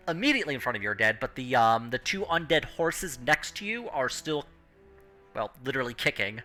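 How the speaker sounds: normal talking voice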